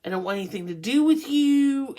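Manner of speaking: acted-out emphatic brush-off; exasperated rejection. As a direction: push them away, fed up. Acting Scorpio's rejection of someone.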